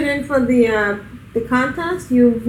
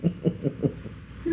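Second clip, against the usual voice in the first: imitating gnome voice; 9 semitones lower, 9 dB quieter than usual. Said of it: "Your deepest gnome voice".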